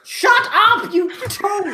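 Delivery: high, loud outburst with a laugh; exasperated shout. Real, not acted. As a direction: yell at a heckling friend, half laughing. Snapping at heckling co-host.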